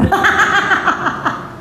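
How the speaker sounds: high-pitched burst of laughter; high peal of laughter. Real, not acted. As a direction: laugh in a long, high staccato run. Laughs after mock confrontation.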